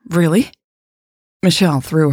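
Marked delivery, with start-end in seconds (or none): 0.1-0.5 mildly excited, rising question; eager, upturned surprise; excited gasp. A narrator's reading. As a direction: ask it with a little gasp of excitement. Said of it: "Jade gasped, excited".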